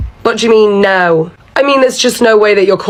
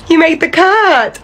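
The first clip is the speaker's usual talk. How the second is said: mock proud announcement; 9 semitones higher, slower than usual. [name] announcing dubious good news.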